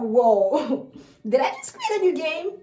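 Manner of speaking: high, amazed little voice with a laugh; delighted baby voice. Retold or imitated. Baby thrilled by parent's reaction, high amazed voice. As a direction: play the thrilled baby discovering a game. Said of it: "they're like".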